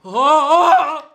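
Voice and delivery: loud cries from the burn; pained yelling. Real, not acted. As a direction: yell out as the heat builds. Eating the hottest pepper.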